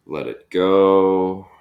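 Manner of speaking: long, loud, sustained release; drawn-out exhale cue. Real, not acted. Instructor cueing the class to breathe out, drawn out and loud.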